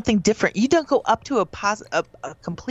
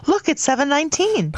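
sudden gasp; 7 semitones higher than usual. Real, not acted. Suddenly notices the time.

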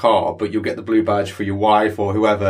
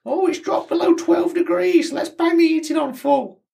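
impression of Tony. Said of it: "bang on impression of you".

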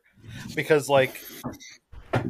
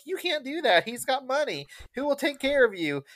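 mom voice; 11 semitones higher, 3 dB quieter than usual. Retold or imitated. Retelling the mother scolding Lisa, a high mock mom voice. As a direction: mimic the pushy mother, pitched way up. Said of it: "her mom's like".

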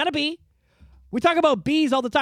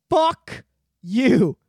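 imitating Elon's defiance; 4 dB louder than usual. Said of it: "He says it like that".

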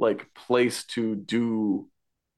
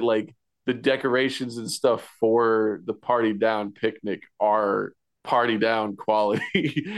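ordinary talk

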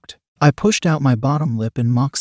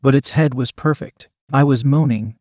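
ordinary delivery, nothing marked

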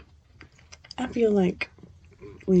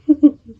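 loud laugh; 9 semitones higher, 11 dB louder than usual. Real, not acted. Laughing at own intro.

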